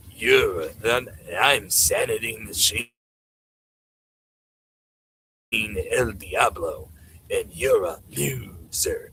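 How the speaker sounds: low, slow mocking boast; lightly voiced villain bragging, slowed down; Sanity Machine impression. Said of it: "that's my impression of Sanity Machine, that loser".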